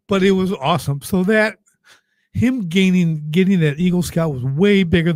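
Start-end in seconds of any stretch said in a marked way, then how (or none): none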